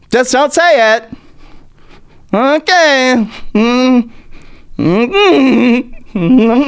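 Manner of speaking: high-pitched, coy put-on voice; mock DeSantis. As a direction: hum and scold in a silly high voice. Acting as prim, coy DeSantis.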